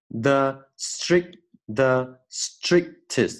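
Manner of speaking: "the strictest" very slowly, broken at the unreleased K; swallowed K. Shown as a demonstration. Teacher breaking the word down, slow "strictest" with silent K. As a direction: halt on "stric", hold the K, then "test". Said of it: "我们把它可以吞掉".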